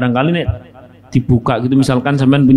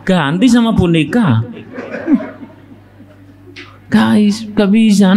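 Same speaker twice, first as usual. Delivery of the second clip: ordinary talk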